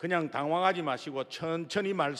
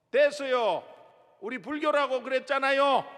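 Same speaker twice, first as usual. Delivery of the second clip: raised voice, higher, shutting them down; curt loud brush-off; 6 semitones higher, 4 dB louder than usual. Retold or imitated. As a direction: snap back through the door. Mother rebuffs evangelist again. (문 너머로 높고 크게 딱 자르듯)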